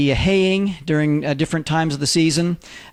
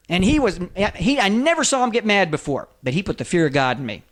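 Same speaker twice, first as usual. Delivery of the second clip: ordinary talk, nothing marked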